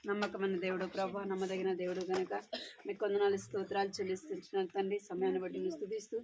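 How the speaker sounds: soft, hushed prayer voice. Acted. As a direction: pray quietly with bowed head. Leads the prayer. (మెల్లని స్వరంతో ప్రార్థన)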